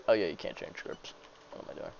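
ordinary talk, nothing marked

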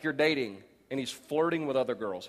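ordinary delivery

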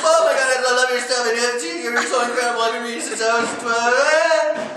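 high, loud gushing fan; fanboying. Retold or imitated. Fan gushing to his comic idol, high and loud. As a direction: gush at your idol, breathless with awe. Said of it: "I just totally fanboyed".

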